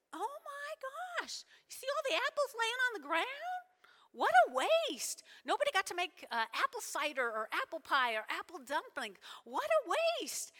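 high-pitched, fretful lament over waste; mock dismay. Orchard visitor appalled by apples on the ground, high mock dismay. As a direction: wail it high, in exaggerated horror.

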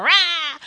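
short loud "rah" roar; mock roar. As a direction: burst out with a playful roar. Imitates a noise mid-joke.

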